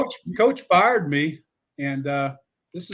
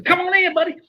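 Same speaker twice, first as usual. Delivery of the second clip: high, louder friendly invite; Spurrier impression; 6 dB louder than usual. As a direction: wave him in like a buddy, pitched up. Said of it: "He says".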